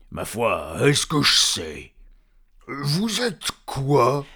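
loud, slow troll voice; puzzled troll. Acted. Troll questioning his tiny captive, slow loud puzzlement. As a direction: drag the question out, loud and lumbering.

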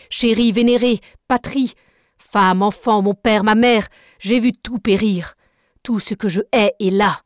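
loud, rising fury through the list; furious tirade. Acted. Nemo raging at the narrator about his lost family, loud and bitter. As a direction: pour out grief and rage, building.

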